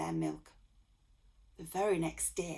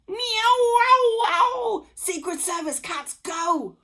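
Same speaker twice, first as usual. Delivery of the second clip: very high, very loud panicked cries; shouting; 13 dB louder, slower than usual. A narrator's reading. Kidnappers shouting in panic at the cats, very loud. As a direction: scream for help. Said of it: "shouted the kidnappers".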